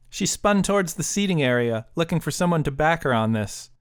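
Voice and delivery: usual speaking voice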